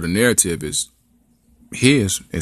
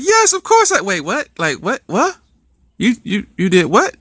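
high shocked double take; high disbelieving what; stunned girlfriend; 13 semitones higher, 3 dB louder than usual. Acted. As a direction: squeal yes then catch it. Girlfriend accepts then realizes.

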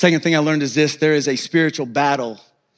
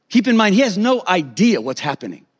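ordinary delivery

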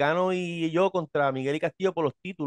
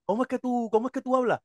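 put-on higher, amazed old man voice; father of Miguelito marveling at his talk, pitched up; imitating don Miguel; 7 semitones higher, faster than usual. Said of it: "Él me decía".

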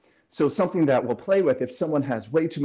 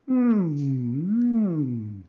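hum demo; 3 semitones higher than usual. Shown as a demonstration. Coach demonstrating hum nose versus mouth. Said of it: "send all the sound to the nose versus".

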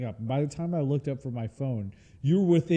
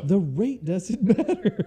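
laughing mock customer; 7 semitones higher, 6 dB louder, slower than usual.

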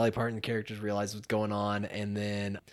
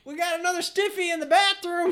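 very high-pitched janitor yell; cartoonish high call-out; imitated janitor hollering. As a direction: holler down the hall to a coworker. Acts janitor yelling to colleague.

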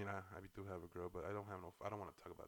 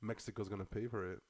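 normal talking voice

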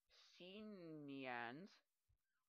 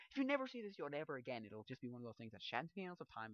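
ordinary talk

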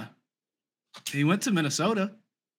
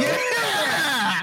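excited; 12 semitones higher than usual. Real, not acted. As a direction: cheer it out in triumph. Celebrating the right answer.

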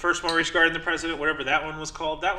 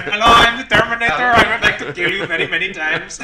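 loud, high, hammed-up Indian accent; 5 semitones higher, 10 dB louder than usual. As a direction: Indian accent played for laughs. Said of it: "an Indian accent this time".

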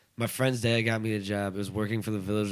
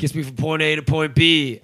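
higher, louder dumb boss voice; 5 semitones higher, 6 dB louder than usual. Retold or imitated. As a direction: play it like a proud simpleton. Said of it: "He's like".

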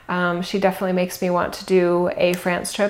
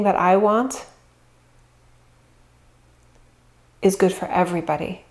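spoken affirmation. Real, not acted.

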